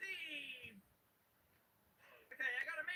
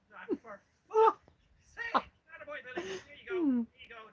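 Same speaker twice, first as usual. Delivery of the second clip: ordinary talk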